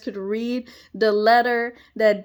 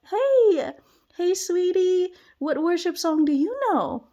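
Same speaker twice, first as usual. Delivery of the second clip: put-on higher, sing-song voice for a little kid; sweet voice to a child; 6 semitones higher than usual. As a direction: coax a small child gently. Talking sweetly to a small child.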